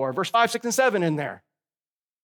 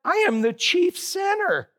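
quoting Paul; 9 semitones higher than usual. Retold or imitated. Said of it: "what Paul said".

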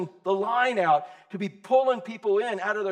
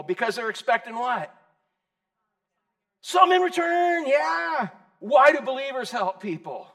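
ordinary talk

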